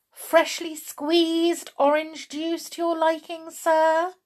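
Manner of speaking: high, loud mock-servile waiter tone; sarcastic. A narrator's reading. Chloe offering Mister Stink orange juice, sarcastic. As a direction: offer it with exaggerated, pointed politeness. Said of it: "she asked sarcastically".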